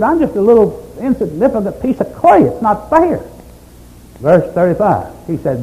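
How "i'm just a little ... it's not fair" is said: high, wailing complaint; anguished protest. Retold or imitated. Job protesting his suffering, high anguished wail. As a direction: cry out at the injustice.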